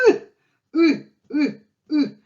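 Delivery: "ooh" as short, high Russian ы grunts; punched-in-the-gut vowel. Shown as a demonstration. Teacher has learners hit themselves, demonstrating high, grunted "ooh". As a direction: push out each "ooh" like a blow to the belly. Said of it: "you just hit yourself over here".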